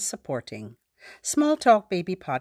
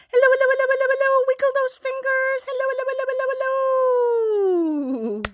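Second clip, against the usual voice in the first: high, rapid-fire gabble, louder at the start; extremely fast; 5 dB louder, much faster than usual. Shown as a demonstration. Wiggling fingers with babies, hello as fast as possible. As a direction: rattle the hellos off in one high stream. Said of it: "as fast as we can".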